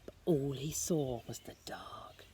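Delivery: hushed breathy whisper. A narrator's reading. Narrator whispers to the child about the dark.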